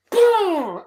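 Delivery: loud, high boom with a laugh; laughing explosion noise; mock explosion. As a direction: blurt the blast sound. Explosion in retold scene.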